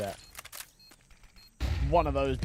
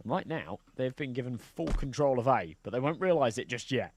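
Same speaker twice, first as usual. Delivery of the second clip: play-by-play commentary; 5 dB louder than usual. Acted. Calm casting of next round.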